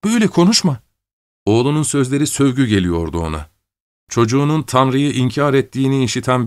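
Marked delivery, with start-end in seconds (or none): 0.0-0.8 shocked plea. Acted. Shocked mother pleads.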